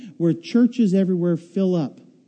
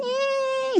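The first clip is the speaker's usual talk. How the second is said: very high, soft mock scream; hammed-up scream in the picture; 5 dB quieter than usual. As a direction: squeal like the ride photo. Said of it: "screaming with your mouth wide open".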